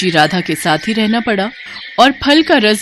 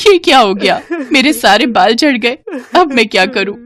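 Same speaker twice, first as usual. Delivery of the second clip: acted wail, pitched up; crying; 4 semitones higher, 3 dB louder than usual. Bride finds her hair gone, crying in dismay. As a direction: lament through stagy sobs, higher. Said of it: "सुधा को जोर से रोना आ गया".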